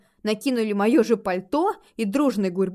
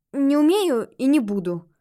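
higher, louder and resolute; firm refusal; 5 semitones higher, 6 dB louder than usual. A narrator's reading. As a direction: refuse it outright, firmly. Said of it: "добавила твёрдо".

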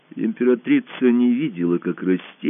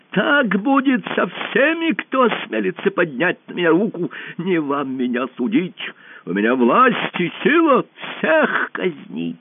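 enraged shout; 10 semitones higher than usual. A narrator's reading. Usurper threatening everyone in the hall, enraged shouting. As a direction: rant at the hall in a high, frenzied cry. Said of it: "закричал в бешенстве узурпатор".

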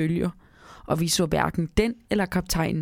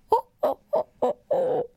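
tiny, very high elf laugh; high-pitched mischievous giggle; little voice laughing. A narrator's reading. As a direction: chuckle in a squeaky little creature voice. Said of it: "siger den lille stemme".

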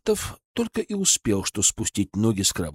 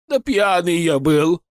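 a touch louder, insistent; stubborn; 5 semitones higher, 4 dB louder, slower than usual. A narrator's reading. Merchant stubbornly insists to the colonel. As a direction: dig in and repeat it stubbornly. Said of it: "упрямо повторил Тароватов".

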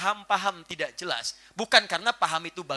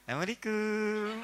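slow sweet greeting; 2 semitones higher, 9 dB quieter, slower than usual. Acted. Husband greets sulking wife.

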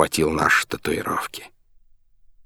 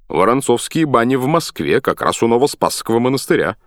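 high, lively character voice; animated exclamation; 6 semitones higher, 4 dB louder than usual. A narrator's reading. Sailor recognises the Moscow bathhouse name, animated. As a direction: exclaim it in a raised pitch. Character reacts to puzzling bath sign.

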